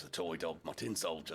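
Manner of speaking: ordinary delivery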